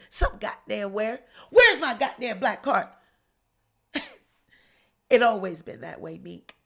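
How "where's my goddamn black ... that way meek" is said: ordinary talk, nothing marked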